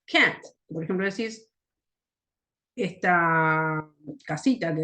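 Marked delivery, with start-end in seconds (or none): 0.0-0.4 cat with a wide open a, said high and loud; cat, punched up with an open a. Shown as a demonstration. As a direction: stress the a in cat, mouth wide. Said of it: "es A".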